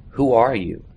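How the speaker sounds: ordinary delivery, nothing marked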